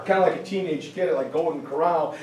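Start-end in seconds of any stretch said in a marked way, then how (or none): none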